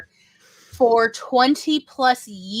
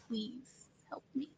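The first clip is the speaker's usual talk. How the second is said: a much softer, slow little plea for help; imitating a small plea; 19 dB quieter, slower than usual. Retelling the friend whose computer broke, pleading quietly. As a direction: mimic a helpless quiet request. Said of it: "she was like".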